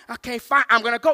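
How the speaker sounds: acted nervous driver; flustered panic. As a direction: give in, rattled and loud. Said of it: "We're panicking at the robots".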